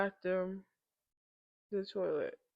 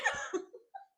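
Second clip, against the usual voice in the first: laughing sob. Real, not acted. Crying laugh after tears.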